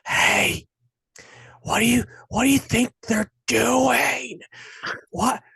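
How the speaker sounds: high, whispery mock chatter of a talking moviegoer; mock nosy moviegoer asking questions mid-film. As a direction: play the rude theater talker leaning over to ask. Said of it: "people are just, like, sitting next to each other".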